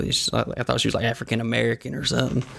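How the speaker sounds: normal talking voice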